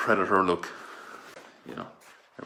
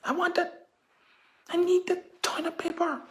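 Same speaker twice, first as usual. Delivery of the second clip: very high, loud desperate whine; desperate begging; 5 dB louder than usual. Retold or imitated. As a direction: whine desperately for the toilet paper. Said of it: "I say".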